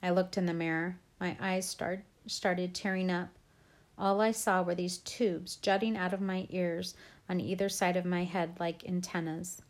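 ordinary delivery, nothing marked

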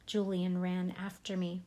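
ordinary delivery, nothing marked

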